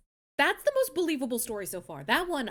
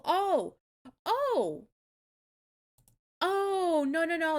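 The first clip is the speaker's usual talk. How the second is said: surprised; 4 semitones higher, slower than usual. Real, not acted. Realizing meaning of unicorn.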